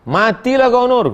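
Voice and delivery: a mother's playful threat to her daughter; mock scolding mother.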